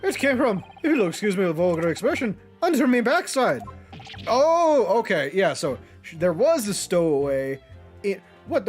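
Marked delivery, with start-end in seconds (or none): 0.0-3.6 lightly voiced Irish old man; folksy Irish witness, slightly raised; Irish accent. 4.3-4.8 sudden startled oh; loud surprised gasp of a word. Real, not acted. Shocked reaction to reveal.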